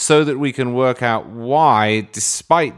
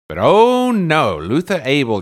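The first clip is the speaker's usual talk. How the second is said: mock indignation; 5 semitones higher, 4 dB louder than usual. Mock outrage at Luther.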